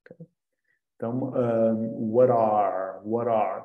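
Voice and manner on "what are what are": what are run together, the t flowing into are; linked what are. Shown as a demonstration. Teacher linking what are for students. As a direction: join what and are into one flow. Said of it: "Estão a ver como uma ... vai se juntar à outra".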